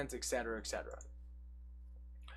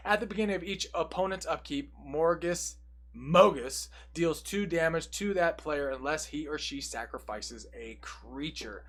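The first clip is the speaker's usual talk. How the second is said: ordinary talk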